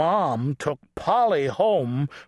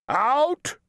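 high, sharp umpire call; referee's cry; 6 semitones higher than usual. A narrator's reading. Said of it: "cried the ref".

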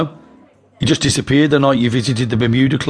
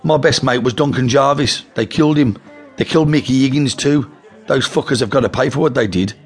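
mock angry grievance; 7 semitones higher than usual. A narrator's reading. Toddy demonizing the Futurals to the twins, angry and higher. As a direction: sell the lie with heat.

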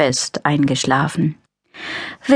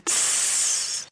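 soft unvoiced hiss; hissing tss; 10 dB quieter than usual. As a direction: let out a short hiss. Same noise again. (leises Zischen)

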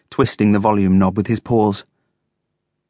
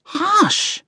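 very high, drawn hush; high-pitched, peeved command; irritated shushing. A narrator's reading. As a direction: shush him in a squeaky-high voice. Coco shushes the noisy radio.

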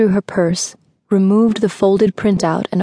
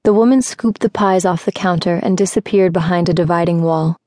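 ordinary delivery, nothing marked

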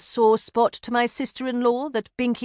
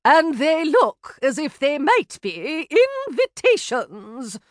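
amazed; 6 semitones higher than usual. A narrator's reading. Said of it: "sounded amazed and a trifle annoyed".

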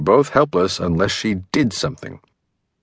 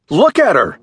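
high and loud, voice raised; upset exclamation; 9 semitones higher, 5 dB louder than usual. A narrator's reading. Husband raises his voice at the detective, high and loud. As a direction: demand they see her. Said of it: "he exclaimed".

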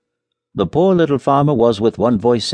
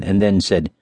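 ordinary delivery

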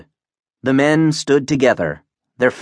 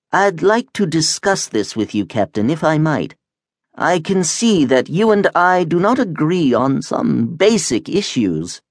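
lightly voiced polite wub, pitched high; high, mildly put-on creature voice; civil talking creature; 6 semitones higher than usual. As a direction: suggest a talk politely, in a higher creature voice.

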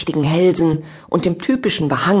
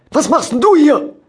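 angry shout; 12 semitones higher, 6 dB louder than usual. Acted. Angry farmer confronts Sandra.

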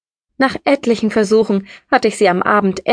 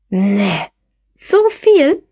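erstaunt; 3 dB louder, much slower than usual. A narrator's reading. Marie staunt über Preise.

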